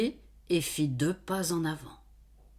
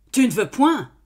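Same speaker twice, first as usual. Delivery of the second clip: pressing demand; 6 semitones higher, 9 dB louder than usual. Acted. Farmer asks again.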